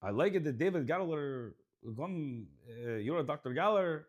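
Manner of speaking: put-on Russian accent; halting, hammed-up Russian accent. Host showing off to his co-host, mock Russian accent. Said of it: "Or my Russian accent".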